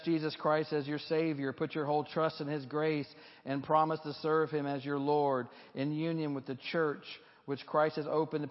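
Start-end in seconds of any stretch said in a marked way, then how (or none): none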